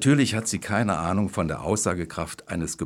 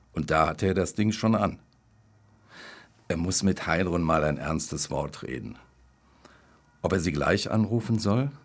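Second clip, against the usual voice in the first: usual speaking voice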